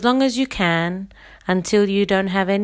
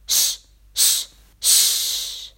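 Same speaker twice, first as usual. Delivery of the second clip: quick unvoiced sh bursts, each one cut off; sh sh sh in short kicks of air; three short shushes; much slower than usual. Shown as a demonstration. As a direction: shush somebody three times. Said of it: "I'll do the example".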